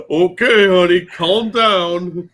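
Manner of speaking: loud, high, drawn-out taunt; loud mock soothing. Co-host to the shouting woman in the clip, loud teasing call.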